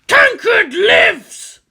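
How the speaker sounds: booming war machine shouting high and slow; dreadnought battle cry. Acted. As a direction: roar it like an ancient war machine.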